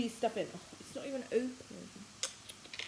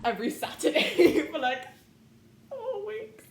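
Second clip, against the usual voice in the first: laughing; 9 semitones higher, 10 dB louder than usual. Real, not acted. Loud laughing complaint.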